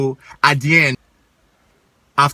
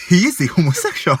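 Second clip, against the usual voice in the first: higher, firm Nigerian mom voice; Igbo mother's voice; 8 semitones higher, 5 dB louder than usual. Retold or imitated. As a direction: play a matter-of-fact Igbo mother. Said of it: "in that Igbo mother's voice".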